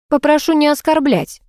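high, coquettish protest; mock indignant. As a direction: object primly but playfully. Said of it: "возмутилась Зуфарова".

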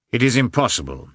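a touch of severity; stern. A narrator's reading. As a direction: refuse sternly, leaning on the words. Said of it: "he replied sternly".

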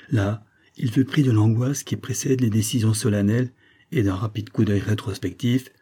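ordinary delivery, nothing marked

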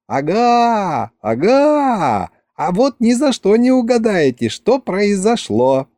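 put-on high, excited girlish voice; gleeful teasing. A narrator's reading. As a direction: tease them with big news, high and drawn out. Excited Masha teasing with her news.

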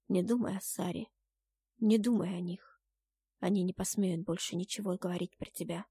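gentle, soothing Jordan; comforting lover. A narrator's reading. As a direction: reassure your beloved quietly.

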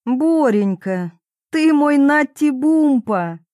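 slow, high, cooing admiration; adoring delight. A narrator's reading. Wife flatters her husband, adoring delight. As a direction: coo it, swelling with delight. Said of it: "Мамуля с восторгом посмотрела на мужа".

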